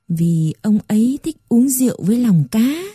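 ordinary delivery

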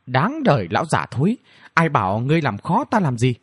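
ordinary delivery, nothing marked

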